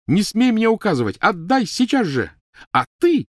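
high, loud commands; enraged yell, turning on the culprit; accusing fury. Acted. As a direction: hurl it like a curse. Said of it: "Она обвиняюще ткнула пальцем".

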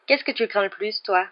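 plain reading voice

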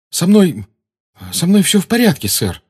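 lightly voiced boy, stammering; a hint of a faltering teenage boy; stammering Harry. A narrator's reading. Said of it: "запинаясь".

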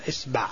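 إصبع as "isba'"; isba', the most eloquent form of إصبع; kasra then fatha. Shown as a demonstration. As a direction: say إصبع with "i" on hamza, "a" on ba. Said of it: "كسر الهمزة وفتح الباء".

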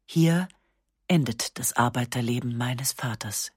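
usual speaking voice

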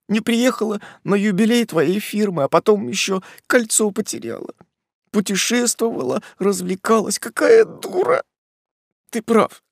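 high, quavering self-blame near sobs; tearful young woman scolding herself; trembling, breaking voice. Acted. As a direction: voice shaking and cracking as she blames herself. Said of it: "Голос у неё дрожал и срывался".

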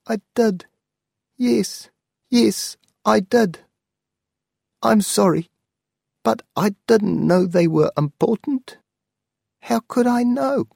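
high, pleading old aunt, voiced well above the narrator; an elderly aunt begging her nephew not to be angry. Aunt admits selling books.

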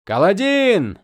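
loud urgent call. Acted. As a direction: call his name across the field. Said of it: "позвал кто-то".

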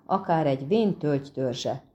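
usual speaking voice

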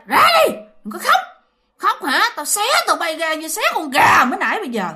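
high, fast menacing scolding; retold threatening yell. Woman threatening crying children, yelled in a high voice. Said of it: "Nói vậy đó".

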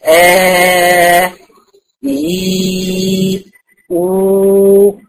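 long steady "آه", then "إي", then "أو"; held vowel demos. Shown as a demonstration. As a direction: stretch each vowel on one flat note. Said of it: "لأني بقول إيه؟". (مدّ "آه" ثم "إي" ثم "أو" بصوت ثابت)

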